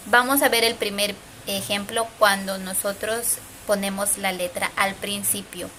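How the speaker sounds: ordinary talk, nothing marked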